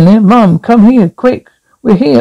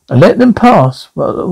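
ordinary delivery

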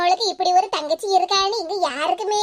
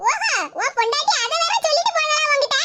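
higher put-on voice of a sneering mother; taunting mother; 7 semitones higher than usual. Mother taunting her son about his wife, higher mocking voice.

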